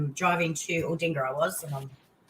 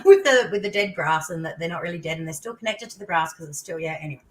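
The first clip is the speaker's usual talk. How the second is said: ordinary talk, nothing marked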